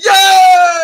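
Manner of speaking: hammed-up crowd yell; crowd cheer.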